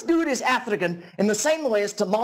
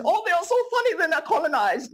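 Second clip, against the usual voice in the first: very high, giggly mock delight; mocking audience member; 10 semitones higher than usual. Comedy club audience laughing at an accent, high-pitched mock amusement. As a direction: squeal it like a tickled club-goer. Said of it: "then laughing at your crappy German accent".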